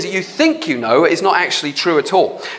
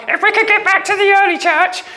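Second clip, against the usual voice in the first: much higher, sing-song parody of the early-church dreamers; mock yearning voice; 12 semitones higher than usual. Preacher mocking early-church romantics, high mock yearning. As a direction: lampoon them in a high, dreamy whine.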